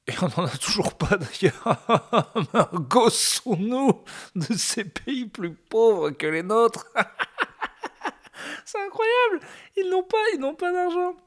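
high, slow, laughing posh mockery; mock snob laughing. Mocking rich snob laughing at poorer countries. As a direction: sneer at the poor through laughter.

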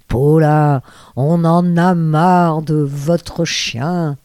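loud, drawn-out fed-up complaint; mock exasperation. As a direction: imitate an exasperated boss, stretching the words out loudly. Producer fed up with her dog. (imitation d'un patron excédé, fort et traînant)

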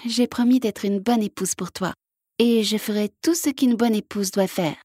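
lightly voiced frog, sincere. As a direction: promise sweetly as the frog. Frog answers prince.

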